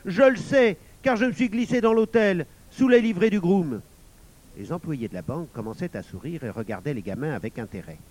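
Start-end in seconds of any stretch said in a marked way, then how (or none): 0.1-3.8 put-on high, loud, triumphant boy; raised, insistent boyish voice; crying out. A narrator's reading. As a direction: reveal insider knowledge loudly. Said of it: "s'écria Gustave".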